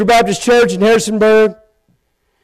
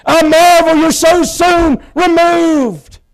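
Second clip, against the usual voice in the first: higher, slow preacher's proclamation; mock evangelist preaching; 6 semitones higher, slower than usual. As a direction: ham up a revival preacher quoting Paul. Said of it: "like the Apostle Paul did".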